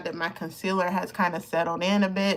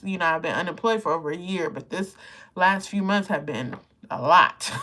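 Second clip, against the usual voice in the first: usual speaking voice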